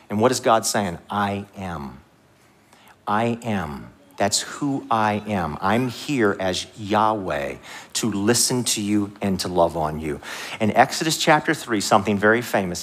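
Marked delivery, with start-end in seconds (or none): 1.1-10.2 low, slow, soft assurance; hushed, measured divine comfort; God speaking tenderly. Acted. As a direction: speak as God, gently and deliberately. Preacher voices God comforting people.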